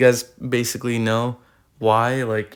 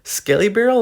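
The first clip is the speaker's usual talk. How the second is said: much higher, louder emphasis; excited; 9 semitones higher, 4 dB louder than usual. Real, not acted. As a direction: pump up the card name. Repeats card name with emphasis.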